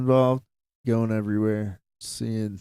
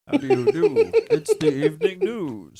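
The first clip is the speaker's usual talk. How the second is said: high, posh-sounding mock anchor; mock evening news greeting; hammed-up newscaster. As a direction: play a prim news anchor. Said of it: "We got the newscaster accent".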